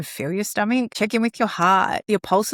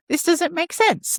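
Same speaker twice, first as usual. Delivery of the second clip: put-on confused child; 10 semitones higher than usual. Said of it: "It's like".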